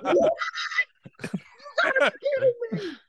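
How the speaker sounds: very high incredulous squeal; can't believe the news; shocked outrage. Retold or imitated. Actor sees headline crediting Ryan Reynolds, squealing disbelief.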